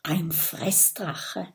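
slow, very soft hushed voice. A narrator's reading. Friends holding breath as heavy steps approach, hushed. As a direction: breathe it out slowly, very quietly.

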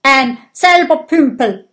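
loud, high flustered shout; shouted insult. Acted. Gudröd shouting in the insult duel, loud and flustered. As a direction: yell the insult and then bounce it back. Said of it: "ruft Gudrud".

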